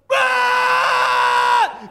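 very high, loud rage scream; enraged roar. Retold or imitated. Enraged driver roaring nose to nose, rage scream. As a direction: let out a wordless rage cry. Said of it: "rage just takes over".

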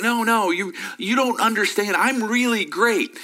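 whiny insistent contestant. Retold or imitated. Contestant pleading, high pitch.

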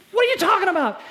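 high, loud disbelief; baffled protest. Acted. Andy demanding what Jesus means, high and loud. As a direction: throw it back in shock.

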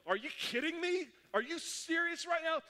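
high-pitched, exasperated disbelief; indignant grumbling. Retold or imitated. Fellow students near the end of a hard test, indignant. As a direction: imitate outraged students complaining. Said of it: "I hear people start grumbling".

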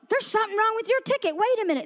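lightly acted agent flagging a problem; ticket agent. Ticket agent stops the traveler.

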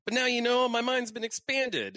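high-pitched amateur impression; Deadpool voice. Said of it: "And Deadpool goes".

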